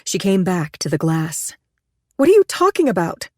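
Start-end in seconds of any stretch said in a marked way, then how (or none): 2.1-3.3 high, raised alarmed question; startled, demanding an answer. Acted. Alarmed woman behind glass.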